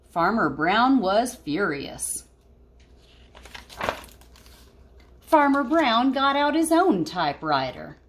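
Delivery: put-on lively read-aloud voice for kids; storybook reading. Teacher reading a picture book to her class, lively.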